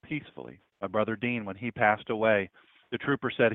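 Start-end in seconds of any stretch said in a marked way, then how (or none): 0.0-0.6 soft, hushed word. Shown as a demonstration. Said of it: "We want to hear the words".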